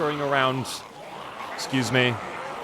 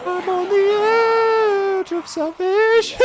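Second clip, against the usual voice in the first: mock wail; much slower than usual. High mock wail at edge.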